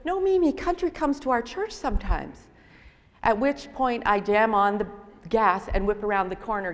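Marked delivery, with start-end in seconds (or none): 0.0-2.5 put-on child protesting. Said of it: "And they're going".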